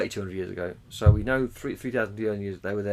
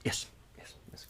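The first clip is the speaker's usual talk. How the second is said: whispering; 13 dB quieter than usual. Real, not acted. Whispered yeses.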